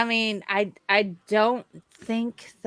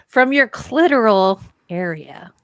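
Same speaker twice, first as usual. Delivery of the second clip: louder, laughing tease; teasing laugh; 9 dB louder than usual. Real, not acted. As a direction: finish his sentence mockingly. Teasing completion.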